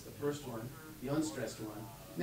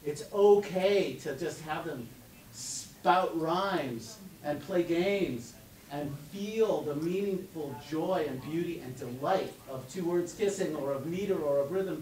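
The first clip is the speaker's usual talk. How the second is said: normal talking voice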